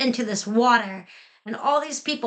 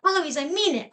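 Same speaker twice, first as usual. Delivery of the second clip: higher-pitched echo of the tutor's scolding; mild mimicking shout; 7 semitones higher than usual. A narrator's reading. Eloise shouts right after her tutor, mild high-pitched mimicking shout. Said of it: "then I shout".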